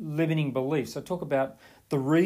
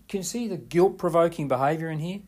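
ordinary talk

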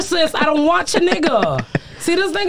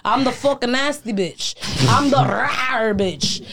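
ordinary talk

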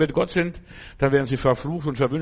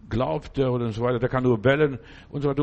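ordinary talk, nothing marked

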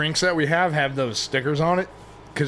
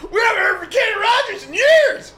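laughing shout; 5 dB louder than usual. Real, not acted. Playing along with the bit.

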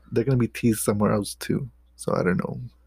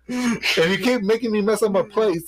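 high, laughing shut-up; playful scolding; 8 semitones higher than usual. Real, not acted. Host scolds the interrupting heckler, playfully indignant and high. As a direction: protest high-voiced through a laugh, then threaten.

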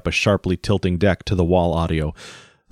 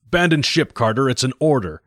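firm order; 6 semitones higher than usual. Acted. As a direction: insist on the order. Captain repeats order.

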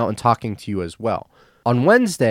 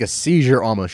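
usual speaking voice

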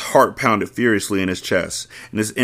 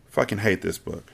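usual speaking voice

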